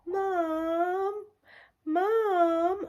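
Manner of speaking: put-on high child calling mum. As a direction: imitate the daughter calling from her room. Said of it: "all I can hear is Sophia".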